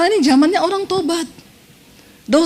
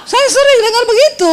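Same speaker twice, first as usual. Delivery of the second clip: hammed-up insistence; 9 semitones higher, 4 dB louder than usual. Real, not acted. Teasing the congregation.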